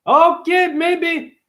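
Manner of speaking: put-on high squeaky Russian accent. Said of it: "Now I sound Russian".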